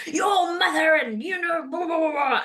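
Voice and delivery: ranting at the husband about his mother; angry rant. Retold or imitated. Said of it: "I'm going".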